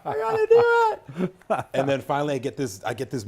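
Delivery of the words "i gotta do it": much higher, louder and slower, laughing through it; laughing, overexcited teen voice; eager excitement. Retold or imitated. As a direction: squeal it like a kid who can't wait. Retold eager teenage inner voice.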